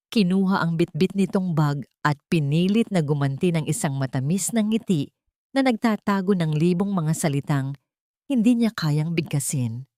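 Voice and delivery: plain reading voice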